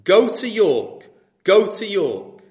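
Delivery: slow, loud, commanding call to go to York; a voice in the night ordering him to York, slow and loud; insistent voice. Acted. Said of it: "prevalent voice saying".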